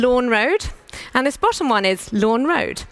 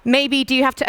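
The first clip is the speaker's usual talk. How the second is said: normal talking voice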